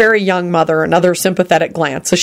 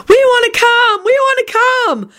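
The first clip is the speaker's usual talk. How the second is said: put-on very high, eager child voices; squeaky, insistent begging; pleading children. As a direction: clamour like excited little kids. Children begging to come along.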